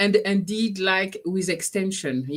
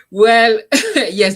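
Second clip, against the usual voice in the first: laughing; 4 semitones higher, 10 dB louder than usual. Real, not acted. Laughing at Amy's comment.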